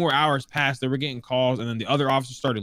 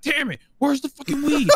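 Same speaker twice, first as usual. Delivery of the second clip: retold cop scream; 12 semitones higher than usual. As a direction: play the furious cop screaming for the weed. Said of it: "and he would start screaming".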